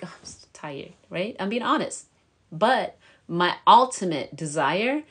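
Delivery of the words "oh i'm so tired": very soft, weary mock complaint; acted tiredness. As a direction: sigh it out quietly, as if worn out. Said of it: "I have days I'm like".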